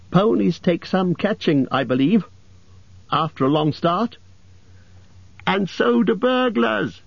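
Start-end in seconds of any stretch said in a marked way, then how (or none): 5.5-7.0 much higher, slow parting jab; parting shot. A narrator's reading. Hobbit taunting dragon while leaving, parting shot. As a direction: fire off a cheeky last word. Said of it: "added as a parting shot".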